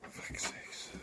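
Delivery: under the breath whisper; whispered curse. Real, not acted. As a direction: curse softly to yourself.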